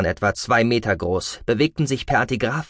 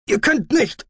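protesting captive; 4 dB louder than usual. A narrator's reading.

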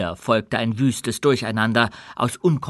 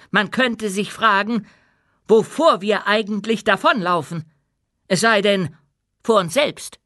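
high, measured oratory to an assembly; rally them with a solemn appeal; stirring queen; 7 semitones higher, 4 dB louder than usual. A narrator's reading. Queen urges dwarfs to be more than forebears, stirring.

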